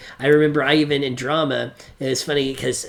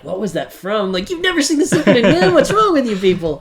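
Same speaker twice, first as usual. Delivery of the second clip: incredulous classmates; 7 semitones higher, 4 dB louder than usual. Retold or imitated. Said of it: "everybody was like".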